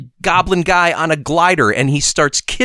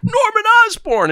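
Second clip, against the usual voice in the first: much higher shout of the name; calling out a name. Retold or imitated. Spider-Man at the wrecked lab, high mock shout. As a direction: call the name into the burning lab. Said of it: "and he says".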